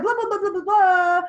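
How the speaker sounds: high-pitched rapid blahs, last one stretched loud; mock fast babble. Coach imitating herself talking fast on live, mock babble. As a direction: rattle off nonsense, high and hurried. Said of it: "I feel like I'm talking fast".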